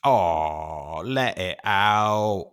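a hammed-up bad British accent, slow and yelled; mock British accent as Don Cheadle did it. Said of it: "a really bad British, uh, accent".

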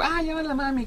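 very high, lilting mock voice; imitating the friend's high voice; high campy imitation; effeminate voice. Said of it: "esa voz de".

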